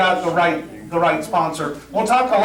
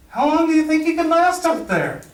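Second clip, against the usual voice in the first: much higher, pushed out the line; exasperated prompt; 9 semitones higher than usual. Retold or imitated. Onstage to a castmate who won't get it, an exasperated higher prompt. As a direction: spell it out in frustration.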